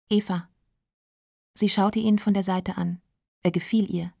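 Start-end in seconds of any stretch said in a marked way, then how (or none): none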